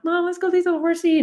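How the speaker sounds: put-on very high, fast child voice; little girl voice. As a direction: chirp it like an eager tot. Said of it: "I'm like".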